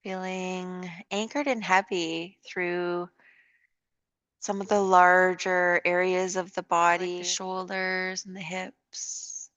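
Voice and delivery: calm, unhurried yoga guidance with long pauses; guided meditation. Real, not acted. Leads a yoga relaxation.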